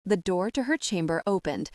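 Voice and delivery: plain reading voice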